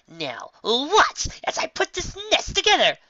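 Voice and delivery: high, slow, loud showman announcement; grand boastful showman voice. Acted. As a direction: present it like a stage magician. Trixie showing off.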